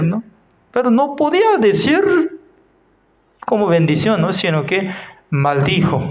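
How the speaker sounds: ordinary delivery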